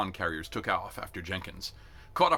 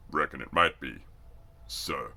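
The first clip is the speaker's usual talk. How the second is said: lower, plain-spoken; big country recruit; 5 semitones lower, slower than usual. A narrator's reading. Injured recruit answers the sergeant, low voice. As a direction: admit it low and steady.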